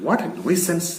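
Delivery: husband brushing off his wife's anger; changed tone. Acted. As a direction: shrug it off lightly. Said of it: "changes his tone".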